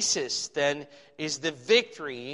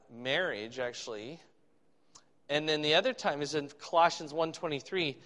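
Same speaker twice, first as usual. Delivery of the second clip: ordinary talk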